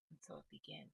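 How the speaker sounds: soft, near-whispered repeat; murmured back to yourself; hushed echo. Shown as a demonstration. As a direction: let the words fall away quietly, like an echo. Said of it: "I hear Spirit say".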